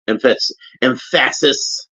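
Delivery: "emphasis" slowed, stress pushed onto the wrong syllable. Shown as a demonstration. Toy reviewer jokes about the wrong syllable, mock mispronunciation. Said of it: "Put the emphasis on the wrong syllable".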